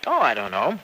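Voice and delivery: ordinary talk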